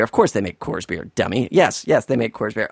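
low, soft muttered self-correction; self-mocking mutter. Correcting his own Coors mistake, low and soft. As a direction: scold yourself under your breath. Scolding himself for the error.

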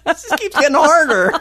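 high, helpless laughing frustration; cracking up while complaining. Real, not acted. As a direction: say it through rising laughter. Laughing at own confusion.

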